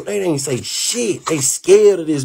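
slow, incredulous drag; slow disgusted drawl about the silent friends. Real, not acted. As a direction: draw it out in disbelief.

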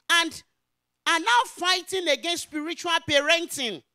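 loud, high, emphatic proclamation; forceful preaching. Real, not acted. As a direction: drive each phrase out to the congregation.

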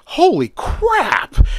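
high, drawn-out cry of astonishment; shocked exclamation. Acted. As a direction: stretch it out in high-pitched, acted shock. Character reacts to huge Twinkie comparison.

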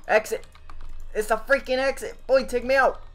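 frantic. Real, not acted. Fleeing to the exit.

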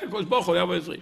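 ordinary talk

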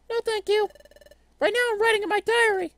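put-on high modest girl voice; high girly character voice. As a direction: protest sweetly, high.

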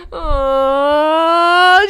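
high, loud held cry. Real, not acted. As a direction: let out a long wail. Loud outcry.

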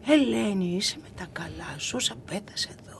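imitated scandalised reply, dropping to a whisper; hushed disbelief. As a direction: start voiced, then whisper it in mock shock. Retold shocked reply about Zabetas.

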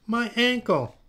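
high, loud, acted whine of pain; wailing. A narrator's reading. Mia fallen on the floor, hammed-up wail. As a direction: cry out over a hurt like a child. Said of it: "wailed Mia".

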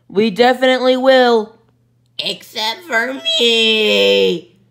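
loud put-on voice; slow sulky aside; character voice. Skit characters reply.

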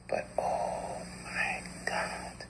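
slow breathless whisper, no voice at all; anguished whisper. Acted. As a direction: let the cry to God escape as a hush.

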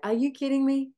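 mock outraged body; incredulous. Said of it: "My body was like".

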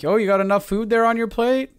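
high, mocking imitation of relatives; high-pitched snide family jab; passive-aggressive dig. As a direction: needle them with a fake-innocent question. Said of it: "these passive aggressive little digs".

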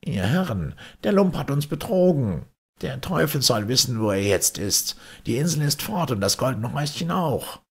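high, indignant merchant voice; cheated merchant. Acted. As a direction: merchant crying out to the others that the scoundrel cheated them, high and indignant. Merchant discovers he was cheated.

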